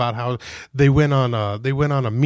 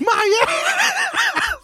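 laughing; much slower than usual. Real, not acted. Laughing mid-story.

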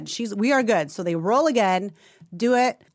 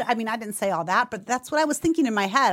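ordinary talk, nothing marked

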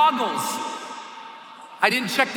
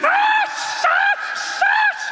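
very high shrieked ah with wind hiss; screaming into wind; much slower than usual. Retold or imitated. Jumper screaming in freefall wind, very high. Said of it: "'cause I'm screaming".

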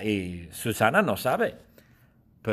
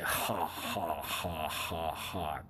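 risa de bebé; 7 semitones lower, 9 dB quieter, much slower than usual. Acted. Bebé Lina se ríe.

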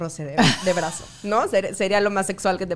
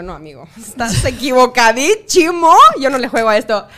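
indignant refusal; 3 semitones higher, 10 dB louder than usual. Retold or imitated. Turning down a Colombian guy's dance offer, loud mock indignation. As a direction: shut him down, hammed-up indignant. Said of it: "Y yo".

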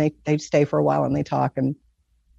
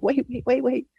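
frantic; 9 semitones higher, 4 dB quieter than usual. Retold or imitated. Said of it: "And I became frantic".

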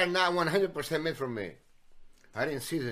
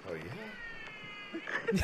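laughing call; 11 dB quieter than usual. Retold or imitated. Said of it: "The girls in line".

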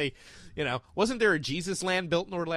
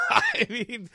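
high laughing burst; 3 dB louder than usual. Real, not acted. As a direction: laugh through the words. Bursts laughing at mime joke.

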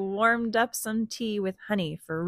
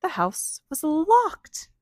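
dramatic gasp; 7 semitones higher, 3 dB louder, slower than usual. A narrator's reading. Storyteller gasps at locked house.

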